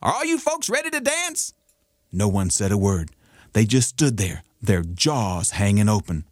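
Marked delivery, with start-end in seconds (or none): 0.0-1.5 high, sly, confident trickster; cocky rabbit voice. Acted. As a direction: tip your hat like a smooth rabbit. Rabbit greets Miss Fox.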